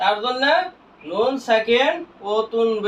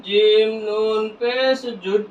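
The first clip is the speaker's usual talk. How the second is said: slow, long-held syllables; measured recitation drill; drawn-out spelling; slower than usual. Shown as a demonstration. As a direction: stretch each letter name evenly.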